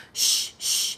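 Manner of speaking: breathy unvoiced shh to scare off a hare; shooing hush. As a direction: hush the rabbit away. Girl shooing the hare away.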